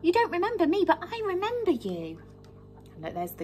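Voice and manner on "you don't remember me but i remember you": put-on higher, boyish Jack; Jack's voice. Teacher voicing Jack greeting the hen, higher. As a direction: lift into a young lad's voice. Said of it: "And Jack said".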